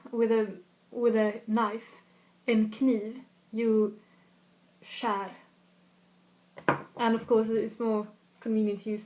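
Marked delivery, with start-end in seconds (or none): none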